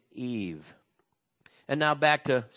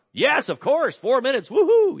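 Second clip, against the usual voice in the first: higher and louder, gleeful, ending in a big whoop; excited whoop; 9 semitones higher, 7 dB louder than usual. Retold or imitated. Preacher acting out his delighted reply to the Google Maps voice, whooping excitedly. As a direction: cheer like you've just won a prize.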